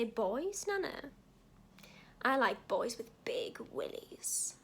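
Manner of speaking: slow, hushed, dropping to a whisper; deliberate shock answer. Retold or imitated. Grandchild shocks nana over weak tea, slow deadpan whisper. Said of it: "look her straight in the eye and say".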